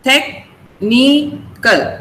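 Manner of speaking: technical very slowly, tech, ni, cal; syllable by syllable. Shown as a demonstration. Teacher counts syllables in technical, slowly split apart. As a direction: break technical into three separate slow beats. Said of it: "There are three syllables there".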